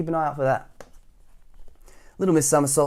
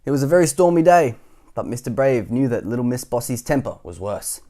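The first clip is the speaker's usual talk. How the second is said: usual speaking voice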